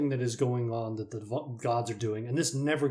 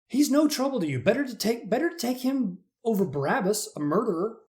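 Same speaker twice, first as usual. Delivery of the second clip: pleading Pilate; 8 semitones higher, 4 dB louder than usual. Acted.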